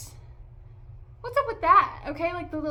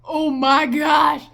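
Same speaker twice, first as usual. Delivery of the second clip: very loud, drawn-out exasperation; loud, slow cry of disbelief; exasperated outcry; 15 dB louder, much slower than usual. Real, not acted. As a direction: blow up in exaggerated exasperation. Exasperated at the character's accent.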